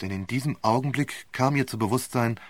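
ordinary delivery

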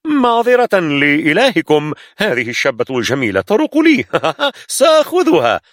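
pirate mocking the soldiers' god. A narrator's reading. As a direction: taunt the crowd, then laugh. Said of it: "رفع يده باستهزاء".

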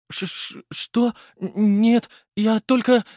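high flustered stammering; startled stammer. A narrator's reading. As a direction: stumble over it in shock.

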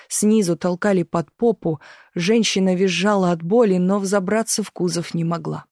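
ordinary delivery, nothing marked

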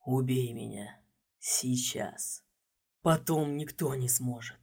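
low, hoarse monster rasp; inhuman rasp. A narrator's reading. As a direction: rasp it out in a voice barely human. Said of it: "прохрипел нечеловеческим голосом".

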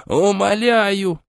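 high, slow desperate cry; frantic shouting hostage taker. A narrator's reading. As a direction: beg with everything. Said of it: "истерично закричала женщина".

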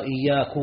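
"إياك" ending in u, iyyaaku; wrong final vowel. Shown as a demonstration. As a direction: say "إياك" with a damma on the kaf, iyyaaku. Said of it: "هذا لحن".

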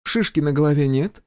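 high-pitched healer, casually inquiring. A narrator's reading. Healer checks the major for a bump, inquiring. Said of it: "поинтересовалась Надежда Владимировна".

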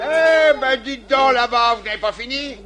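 loud, high bark across the yard; shouting. Acted. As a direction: yell at the whole rank. Adjudant yelling at the ranks.

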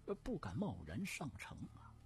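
deferential reply with small hesitations; hesitant eunuch servant. Acted. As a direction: answer the Empress cautiously and humbly. (太监吞吞吐吐地恭敬回禀)

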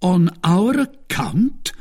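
slow, high-pitched questioning; astonished question. A narrator's reading. Musketeers puzzled by wine sent in their name, surprised.

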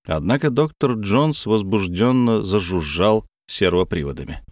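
ordinary delivery

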